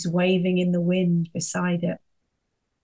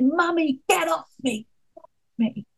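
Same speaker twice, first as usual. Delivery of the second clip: put-on high little boy's voice, lightly done; child protesting; 9 semitones higher than usual. Little son struggling under mum's hug, high mock protest. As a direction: play the small son pushing mum away. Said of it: "And he said".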